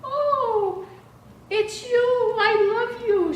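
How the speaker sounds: put-on high, loud, childlike old mother voice; elderly mom gushing high and loud; mother's voice. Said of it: "And she said".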